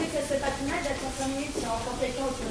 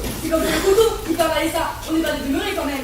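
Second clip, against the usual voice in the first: loud outburst; 3 semitones higher, 10 dB louder than usual. Acted. Juror blurts out in the deliberation.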